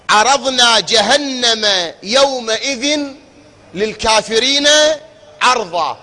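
emphatic tajwid recitation; Quran recitation. Acted. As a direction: chant it out with force and length. Recites verse with force.

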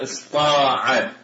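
"استطاع" shortened to isTaa'a with heavy ط; emphatic ط form ISTAA'A. Shown as a demonstration. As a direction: drop the ت from "استطاع", keep an emphatic ط. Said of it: "الطاء والتاء في". (نطق "استطاع" اسطاع بطاء مفخمة)

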